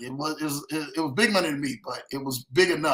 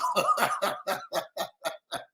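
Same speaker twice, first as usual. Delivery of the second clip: breathy falling laugh; 5 dB quieter than usual. Real, not acted. Laughing about going to jail.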